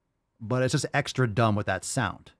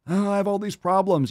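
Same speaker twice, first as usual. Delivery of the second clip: higher, whiny mock victim; mock self-pity; 6 semitones higher than usual. Reactor mocking people with health complaints, mock self-pitying. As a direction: moan it as a parody complainer.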